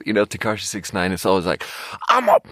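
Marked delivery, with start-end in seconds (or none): 2.1-2.4 high, strained rapper yell of tender words. Retold or imitated. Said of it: "the weirdest, softest stuff in the most aggressive way".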